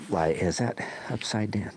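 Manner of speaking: soft unvoiced whisper to himself; quiet whispered self-talk; whispered aside. Real, not acted. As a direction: whisper the question under breath.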